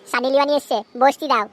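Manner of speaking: normal talking voice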